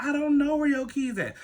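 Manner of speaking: high put-on voice of denial; mimicked cousin. Cousin denies knowing where keys are, high mimicked voice.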